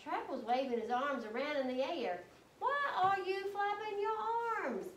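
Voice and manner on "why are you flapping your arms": high mock shout; shouting at him. A narrator's reading. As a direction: yell up at a cousin flapping about. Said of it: "I shouted at him".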